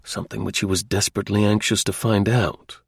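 usual speaking voice

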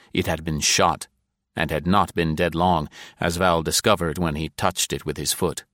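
ordinary delivery

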